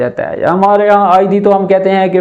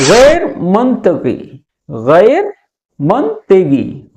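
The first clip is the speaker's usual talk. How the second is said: very slow, syllable by syllable गैर मंतकी; slow pronunciation; much slower than usual. Shown as a demonstration. Teacher slowly models गैर मंतकी, very slow. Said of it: "गैर मंतकी यानी कि".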